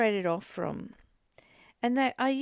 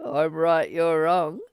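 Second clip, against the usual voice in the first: loud, lower, mocking a know-it-all; louder hammed-up righteous claim; 6 semitones lower, 8 dB louder, slower than usual. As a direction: play the smug one who's always right. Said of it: "You know".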